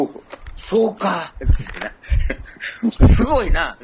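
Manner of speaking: drawn-out mock amazement; a listener reacting, impressed. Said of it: "聞いてる方も".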